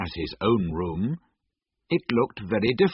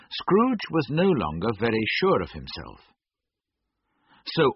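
usual speaking voice

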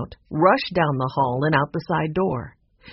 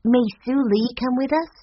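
put-on high-pitched young girl asking; girl's voice; 8 semitones higher than usual. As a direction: ask as a hopeful child. Girl Violet asks her grandfather.

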